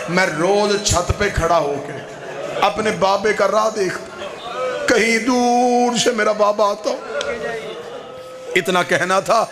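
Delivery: rising majlis lament. Acted. Zakir driving mourners to tears, wailing climax. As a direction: build from grief to an anguished cry.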